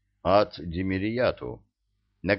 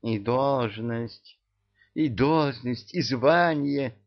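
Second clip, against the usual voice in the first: slow, sweet-talking promise; coaxing; 5 semitones higher, slower than usual. A narrator's reading. Yaga promising the cat a post and rank, cooing. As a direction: coax slowly, pitch climbing. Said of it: "заворковала она над Баюном".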